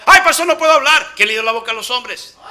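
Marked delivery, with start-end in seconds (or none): 0.0-1.1 fast, high whining Moses; whiny excuse. Acted. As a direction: plead off the job in a hurry. Moisés voiced with excuses. (Moisés quejumbroso y rápido)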